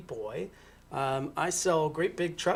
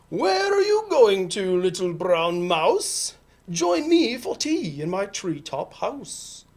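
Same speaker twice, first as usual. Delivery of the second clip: loud, drawn-out owl voice; hooting owl; 4 semitones higher, 7 dB louder, slower than usual. Acted. Owl invites mouse to tea, loud and drawn-out. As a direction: call out big and slow as the owl.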